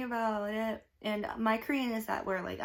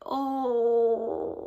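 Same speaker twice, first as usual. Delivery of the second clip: emotional oh; 7 dB louder than usual. Retold or imitated. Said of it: "I'm just ugly sobbing".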